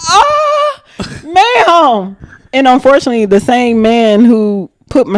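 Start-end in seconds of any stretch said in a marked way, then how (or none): 0.1-2.2 high laughing yelp of pain. Retold or imitated. A woman yanked by a zipline strap, high laughing yelp. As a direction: yelp as the strap yanks tight. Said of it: "I was like".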